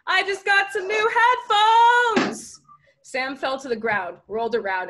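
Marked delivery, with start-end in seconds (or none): 0.1-2.6 high, loud, stretched-out call; slow high-pitched taunting yell; yelling back. A narrator's reading. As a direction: holler it gloatingly over a distance. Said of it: "Sierra yelled back".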